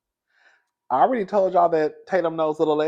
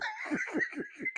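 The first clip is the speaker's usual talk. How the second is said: soft high stifled laugh; 16 dB quieter than usual. Real, not acted. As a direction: laugh under your breath.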